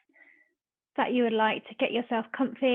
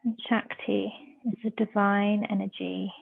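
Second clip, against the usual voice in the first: measured meditation voice with long pauses; guided meditation. Real, not acted. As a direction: guide them into stillness, phrase by phrase.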